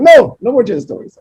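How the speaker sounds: high, loud mock protest; mock student groan. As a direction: students begging him to stop. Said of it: "And they're like".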